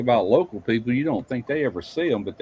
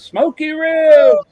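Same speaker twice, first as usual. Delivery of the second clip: loud high slow shout across the bayou; calling across the water; 9 dB louder, much slower than usual. Retold or imitated. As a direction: hail him from far off. Guy yelling across the bayou.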